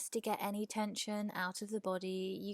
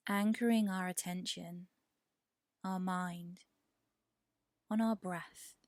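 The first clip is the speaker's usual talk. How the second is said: guided meditation. Real, not acted. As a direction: guide them gently toward the breath, unhurried. Leads a yoga nidra meditation.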